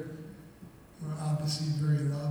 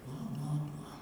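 bored, softer brush-off; dismissive; 10 dB quieter than usual. Real, not acted. Brother mocking messages he ignored, dismissive and soft. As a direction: wave it all away. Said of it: "dismissing the messages".

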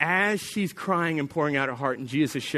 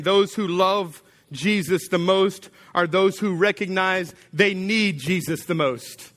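usual speaking voice

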